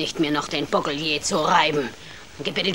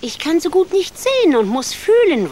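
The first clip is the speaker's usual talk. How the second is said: much higher, louder witch screech; shrieking witch; 11 semitones higher, 7 dB louder than usual. Acted. Witch to Hänsel in the stall, screeching. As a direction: screech it like a cruel hag.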